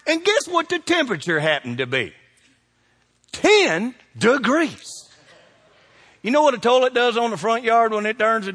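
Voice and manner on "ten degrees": slow punchline. Real, not acted. Punchline about freezing weather.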